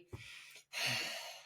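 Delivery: audible frustrated huff; huffy sigh. Shown as a demonstration. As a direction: sigh it out like you're fed up. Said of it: "the difference between".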